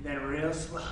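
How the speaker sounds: low, soft striptease tease; teasing, hushed. Pretend. Dancer peeling off his undershirt, teasing and hushed. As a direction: drop right down low and quiet to tantalize. Narrates slow striptease peel.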